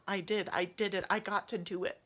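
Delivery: ordinary delivery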